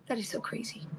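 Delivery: breathless whisper of disbelief; whispered amazement. Real, not acted. Telling viewers about the Labubu car seat in an amazed whisper. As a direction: hush it, amazed.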